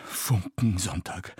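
slow, breath-laden gasp. A narrator's reading. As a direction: gasp the word out. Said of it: "keuchte eine Frau".

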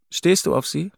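lightly voiced worried wife; tense wife voice. A narrator's reading. Wife asks tense question.

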